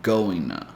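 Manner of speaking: "going to" with no T, linked to the N; goin' a; dropped T going to. Shown as a demonstration. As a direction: say "going to" as "goin-a". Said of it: "So you can say".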